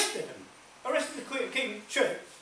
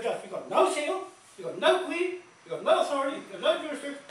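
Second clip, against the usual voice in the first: higher-pitched, emphatic courtroom challenge; retold defiance; 7 semitones higher than usual. Telling a judge he has no authority, defiant. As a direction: hammer out each point to the judge.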